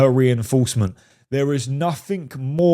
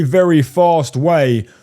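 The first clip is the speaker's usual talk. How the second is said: ordinary talk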